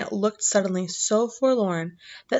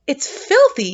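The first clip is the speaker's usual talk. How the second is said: spluttering it out louder and higher; spluttered; 6 semitones higher, 6 dB louder, slower than usual. A narrator's reading. Giant spluttering with his mouth full at Sophie. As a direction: spit the word out in disgust. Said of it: "he spluttered".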